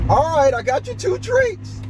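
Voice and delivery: high, bright coaxing call to the dogs; treat voice for the dogs. Real, not acted. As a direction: pitch it way up to offer the pups a treat.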